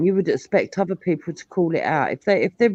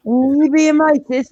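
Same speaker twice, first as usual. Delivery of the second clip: slow, higher, louder indignant demand; indignant question; 9 semitones higher, 6 dB louder than usual. Retold or imitated. Maku accusing her of racism, slow and indignant. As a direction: drag it out, offended and accusing.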